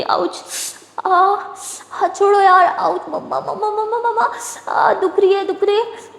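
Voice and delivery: put-on a hurt girl calling for her mother; crying out in pain. A narrator's reading. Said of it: "दर्द के मारे चीख निकल".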